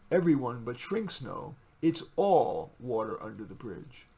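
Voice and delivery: loud, slow, measured declamation; poetry reading. Acted. Poet reading his poem to viewers, slow declamation. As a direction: read it as a slow spoken-word piece. Reads poem Water Under the Bridge.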